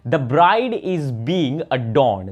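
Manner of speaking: very slow, word by word; slow example sentence. Shown as a demonstration. Teacher reads an example to students, very slow. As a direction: read it out slowly for learners to follow. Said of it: "जैसे कि मैंने बोला".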